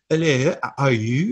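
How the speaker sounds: put-on hinting at a heavy French accent on "Hello, how are you?"; a light imitation of a French actor's English accent. Co-host imitating Christopher Lambert's heavy French accent. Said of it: "very heavy French accent".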